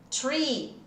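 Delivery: "Tree" with a long I sound; long ee vowel. Shown as a demonstration. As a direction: draw out the ee in "Tree". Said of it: "Som de I". ("Tree" com som de I)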